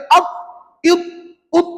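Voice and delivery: "ap, it, ut" stopped dead on the consonant; no bounce. Shown as a demonstration. Teacher showing a faulty reading, unbounced "ap, it, ut". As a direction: cut "ap, it, ut" off with no echo after the stop. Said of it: "Itu tidak melantun".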